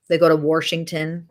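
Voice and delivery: "Washington" said "Warshington"; local-style "Washington" with an added R; regional pronunciation. Retold or imitated. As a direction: put an R into "Washington". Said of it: "That's not how it's spelled".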